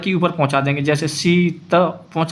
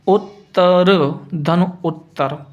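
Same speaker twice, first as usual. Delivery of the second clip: slow dictation; much slower than usual. Shown as a demonstration. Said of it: "जैसे".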